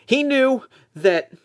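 cornered dirty cop pleading; high, defensive excuse; defensive NPC voice. Acted. GM voices NPC Ronnie confronted.

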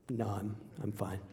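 very soft, low, hesitant reply; reluctant refusal. Retold or imitated. Son turning down dad's hunting invitation, very quiet and hesitant. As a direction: decline very softly, pitched low.

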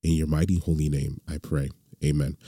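quiet, earnest prayer voice. Acted. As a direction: pray low and sincere. Opening prayer over the podcast.

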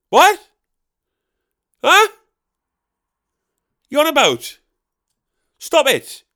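high, loud incredulous What; baffled outburst. Retold or imitated. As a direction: yell it in comic disbelief. Baffled reaction to heckler.

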